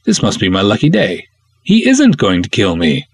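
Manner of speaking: plain reading voice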